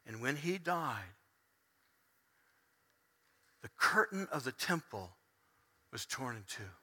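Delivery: very soft, low, with long pauses; hushed solemn preaching. Acted. Preacher telling congregation of Jesus on the cross, hushed. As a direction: let it sink into near silence.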